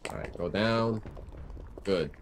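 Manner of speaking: soft, low determined mutter. Real, not acted. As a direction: grumble it under your breath. Continued low focused muttering.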